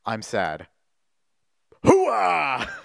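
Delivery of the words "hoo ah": loud, high military "hoo-ah"; loud gung-ho soldier. Acted. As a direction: bark it like a marine. Shouting a soldier cheer.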